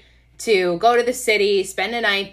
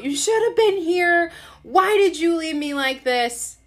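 retold tearful screaming; 9 semitones higher than usual. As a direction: imitate yourself wailing accusations down the phone. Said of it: "screaming on the phone".

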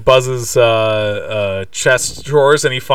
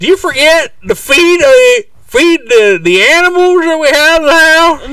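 very high, loud, slow falsetto; hammed-up put-on voice, close to laughing; silly mock questioning; 7 dB louder, slower than usual. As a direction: squeal the question with dragged-out words. Mimics worried question in silly voice.

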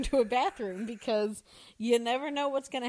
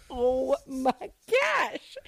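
stunned aside; 2 semitones higher, 4 dB louder, much slower than usual. Retold or imitated. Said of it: "It's like".

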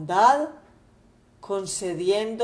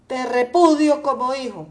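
angry father; 3 semitones higher, 5 dB louder than usual. Acted. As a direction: scold the son and forbid his return. Padre furioso repudia al hijo. (padre indignado, voz algo más alta)

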